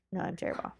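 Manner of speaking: ordinary delivery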